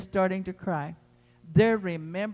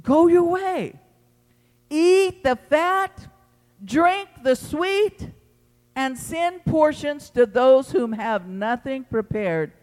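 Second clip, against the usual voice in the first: higher, louder, slow proclaiming; preached proclamation; 6 semitones higher, 6 dB louder, slower than usual. Acted. Preacher proclaiming the priest's words, slow and ringing. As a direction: ring out each phrase like a herald.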